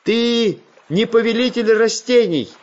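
high, heated outcry; shouted accusation. A narrator's reading. Pencil shouts at the hidden plant lord, indignant outcry. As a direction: fling the insult at him. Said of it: "выкрикнул Карандаш".

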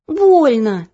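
whiny cry of pain; mock cry. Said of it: "дёргалась и вскрикивала". (вскрикнула)

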